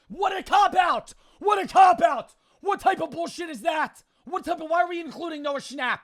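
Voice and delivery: high, loud indignant yelling; high-pitched shouted protest; outraged shouting. Real, not acted. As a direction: rant at the top of your voice. Outraged at unfair game item.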